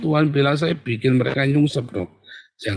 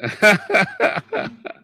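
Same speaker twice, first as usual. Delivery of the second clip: high, put-on cackle; mocking laugh; 9 semitones higher than usual. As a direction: let out a high mock laugh. Mocking laugh after the recitation. (tawa tinggi mengejek)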